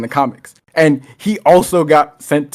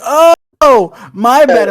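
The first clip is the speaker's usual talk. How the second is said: mock apologetic cop; 7 semitones higher, 5 dB louder, slower than usual. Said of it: "And he's like".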